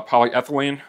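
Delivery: normal talking voice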